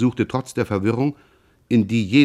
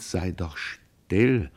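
softer, very slow panting plea; quieter, panting; 2 semitones lower, 6 dB quieter, much slower than usual. Acted. Pierre panting at his brother: soft slow plea. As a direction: breathe it out, barely holding on. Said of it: "Er wiederholte leiser, keuchend".

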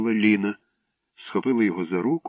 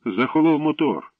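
higher, louder worried remark; 7 semitones higher, 4 dB louder than usual. A narrator's reading. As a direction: fret over the engine. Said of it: "заклопотано сказав Славко". (заклопотано, вище й гучніше)

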